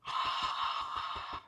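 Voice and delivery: long breathy ha exhale; dramatic sigh. Shown as a demonstration. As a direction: sigh it all out. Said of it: "Ha is the healing sound for the heart".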